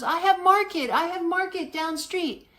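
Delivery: high, insistent vendor's broken English. Retold or imitated. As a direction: coax the tourist in broken English. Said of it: "She goes".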